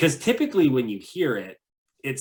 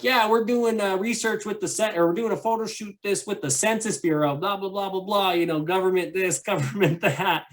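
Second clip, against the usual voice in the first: breezy cover story; 9 semitones higher than usual. Retold or imitated. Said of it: "he's like".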